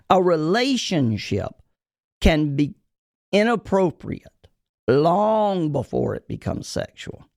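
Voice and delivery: slow, deliberate, broken up by pauses; emphatic repeat. Shown as a demonstration. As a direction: spell it out phrase by phrase so they hear it. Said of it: "because I want you to hear it".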